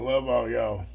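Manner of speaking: ordinary delivery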